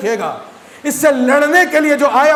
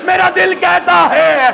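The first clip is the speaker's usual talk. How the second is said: preaching at full force; 4 semitones higher than usual. Acted. Rising mourning recitation.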